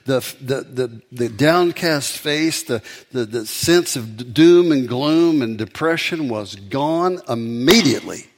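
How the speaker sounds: loud, slow, rising preacher's build; preaching at full force. Acted. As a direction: preach the climax slowly and loudly. Preaching climax of the story.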